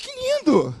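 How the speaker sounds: light up with delight; delighted exclamation. Retold or imitated. Pastor delighted by the shy girl's answer, excited.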